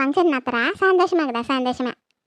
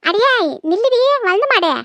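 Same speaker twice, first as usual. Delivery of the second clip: higher, angry call to stop her; calling out angrily; 7 semitones higher than usual. Acted. Mother-in-law calling after her departing daughter-in-law, angry call.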